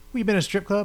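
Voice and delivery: high mock wife question; imitating her suspicious question. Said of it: "She goes".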